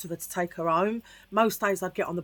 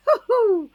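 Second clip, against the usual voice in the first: sudden high burst of laughter; a high squeal of a laugh; loud laugh; 14 semitones higher, 9 dB louder than usual. Real, not acted. Laughs mid-story about naming her.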